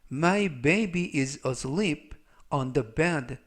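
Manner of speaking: very slow, word by word English; slow dictation reading. Shown as a demonstration. Teacher reads the example sentence for students, very slow. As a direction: read it out slowly so learners follow.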